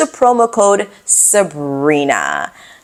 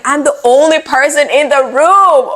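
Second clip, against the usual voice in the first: exasperated; 7 semitones higher, 3 dB louder than usual. Retold or imitated. Exasperated reply to police.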